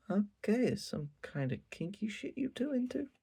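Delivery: teasing suspicion. Retold or imitated. Najimi teasing Tadano about the wardrobe, high and teasing. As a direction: tease them like you caught something kinky. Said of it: "She's like".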